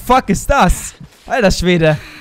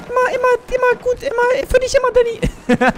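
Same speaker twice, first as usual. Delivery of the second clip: squeaky falsetto, much higher than usual; sing-song high squeak to a friend. Acted. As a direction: go way up into a silly falsetto. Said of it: "ich kann diese hohe Stimme nicht mehr".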